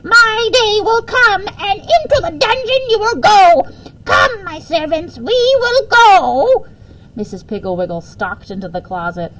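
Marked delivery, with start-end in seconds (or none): 0.0-6.7 very high, loud, slow shrieking evil queen; hammed-up screeching queen, raging at the slaves; furious queen's yell. A narrator's reading. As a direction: shriek like a wicked queen cheated of her prey. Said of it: "She yelled".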